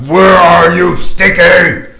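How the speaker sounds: loud high bellow calling out; shouting. Acted. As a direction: roar it across the swamp. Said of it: "Orgon's like".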